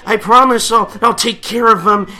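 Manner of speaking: loud, high mock begging; pleading kid voice. Girl promising to look after the dog, mock pleading. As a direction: promise in a hammed-up little-girl squeal.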